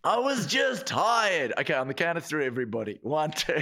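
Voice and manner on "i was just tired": high, drawn-out mock call; slow mock-pleading yell, pitched up. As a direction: stretch it out as a public excuse. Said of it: "Yell that out".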